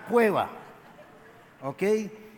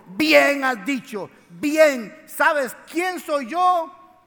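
high, loud and slow, each word leaned on; emphatic Jesus voice; 6 semitones higher, 7 dB louder, much slower than usual. Acted. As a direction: proclaim it to Marta with full weight. Jesus answers Marta, preacher voicing him.

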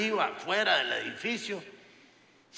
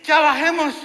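high, loud plea to get down; father begging the family to go down now; urgent call; 9 semitones higher, 10 dB louder than usual. Retold or imitated. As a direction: blurt it out desperate to leave. Relieved father calling.

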